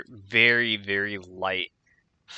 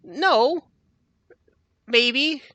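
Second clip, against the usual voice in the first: high, loud, whining voice; whiny prisoner; 7 dB louder than usual. Acted. Johnny whining to Ron about Mama's knife, high and loud. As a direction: fret like a kid about Mama.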